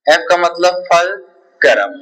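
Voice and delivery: each word spoken slowly and clearly, fulcrum completed with a fall; slow dictation that settles on fulcrum. Shown as a demonstration. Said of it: "teacher dictates the answer".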